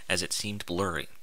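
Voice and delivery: normal talking voice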